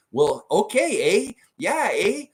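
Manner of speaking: put-on high, slow, drawn-out Canadian accent with a rising eh. Visiting cousins in Winnipeg, slipping into a slow Canadian accent. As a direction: ham up a Manitoba Canadian accent, stretching each eh. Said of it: "Way more Canadian sounding".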